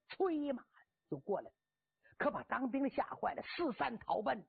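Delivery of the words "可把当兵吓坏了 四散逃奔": ordinary delivery, nothing marked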